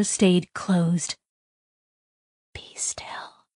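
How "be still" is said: breathy unvoiced hush; whisper. A narrator's reading. The voice hiding the girl from monsters, whisper. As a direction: whisper so they won't hear. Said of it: "the voice said in a whisper".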